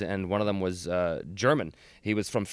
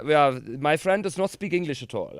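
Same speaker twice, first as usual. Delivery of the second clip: higher-pitched mock-German English; hammed-up German accent, explaining for a friend; fake German accent; 4 semitones higher than usual. Covering for his friend to the girls, fake German accent. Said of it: "before my German friend could say anything".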